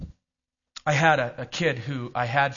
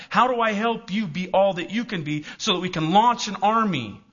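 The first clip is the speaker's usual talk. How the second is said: usual speaking voice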